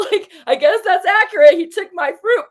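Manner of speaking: high, loud laugh through the words; laughing admission. Real, not acted. Vlogger laughing at her own lesson about the fruit, high and loud. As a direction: laugh at herself as it clicks.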